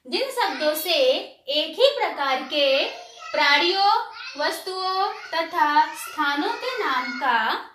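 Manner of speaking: slow, measured definition read-out; slow dictation. Shown as a demonstration. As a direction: spell it out phrase by phrase for the class. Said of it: "धीरे धीरे उसे बोलती हूँ". (धीरे-धीरे, रुक-रुक कर बोलते हुए)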